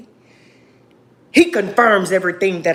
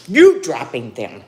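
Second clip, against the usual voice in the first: the same words hit louder; firmer, louder second telling; louder repeat; 6 semitones lower, 5 dB louder than usual. Real, not acted. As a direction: push the repeat out harder. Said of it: "repeats the line for emphasis".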